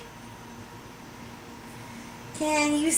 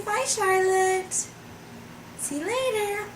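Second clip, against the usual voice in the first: ordinary talk, nothing marked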